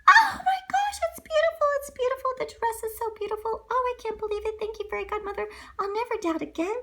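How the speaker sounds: put-on high, gasping girl voice, overjoyed; thrilled girl. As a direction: squeal over the gown. Cinderella sees new dress.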